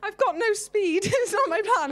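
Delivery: high, amused self-mocking reply; laughing protest. Real, not acted. Swimmer denying to interviewer it was her plan, laughing protest. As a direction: laugh it off, pitched right up.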